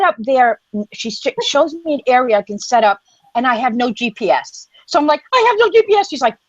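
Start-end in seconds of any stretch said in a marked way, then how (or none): 5.3-6.1 high, alarmed cry of no signal; alarmed complaint that the phone has no signal; panicked. Retold or imitated. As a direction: blurt it out in alarm.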